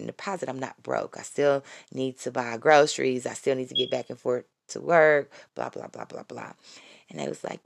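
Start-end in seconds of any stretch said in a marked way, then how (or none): 5.5-6.5 dismissive blah blah. Real, not acted. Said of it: "trailing off list".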